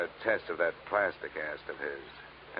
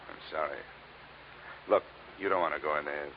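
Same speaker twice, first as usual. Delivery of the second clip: low, softer reassurance; gentle consoling; 5 semitones lower, 7 dB quieter than usual. Acted. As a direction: ease him away from the room kindly. Detective gently breaks bad news.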